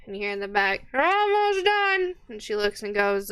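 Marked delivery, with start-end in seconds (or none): 0.9-2.1 distant cook voice. Acted. Cook calls back from kitchen.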